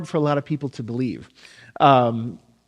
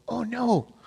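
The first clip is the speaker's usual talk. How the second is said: put-on high, soft childlike voice; little kid's voice; 7 semitones higher, 8 dB quieter than usual. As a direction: imitate a small child, quietly. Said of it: "little kid's voice go".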